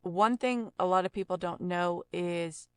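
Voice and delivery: ordinary talk, nothing marked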